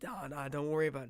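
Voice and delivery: quick retold dismissal; brushing it off. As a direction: wave the question away in a hurry. Friend brushes off the question.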